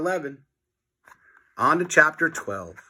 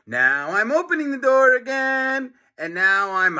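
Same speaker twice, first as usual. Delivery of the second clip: taunting teacher; 10 semitones higher than usual. Acted.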